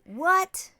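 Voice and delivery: high, loud, rising mock shock; playful disbelief. Host delighted by accents on babies, playful disbelief. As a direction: squeal the question upward.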